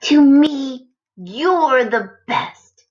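loud, slow, tender; tender little brother. A narrator's reading. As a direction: say it lovingly to the brother. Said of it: "said little brother".

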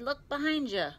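high-pitched urgent call; shouting. A narrator's reading. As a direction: call out a quick heads-up. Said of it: "Tigger shouted".

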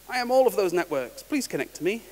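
a gadget that says yes to everything; eager yes man. Acted. Rogue access point answering every device.

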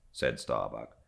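usual speaking voice

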